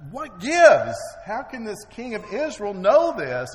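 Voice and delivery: loud, high furious demand; angry king. Retold or imitated. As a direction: bellow it like a baffled, enraged king.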